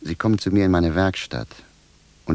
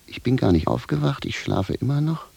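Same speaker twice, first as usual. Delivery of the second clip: normal talking voice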